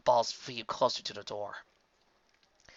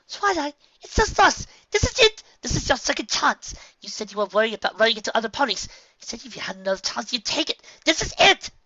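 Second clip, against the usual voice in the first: urgent pleading; 11 semitones higher, 7 dB louder than usual. A narrator's reading.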